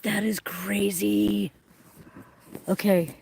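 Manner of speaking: slow awed whisper. Real, not acted. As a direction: breathe it out in wonder. Awed whisper during eclipse.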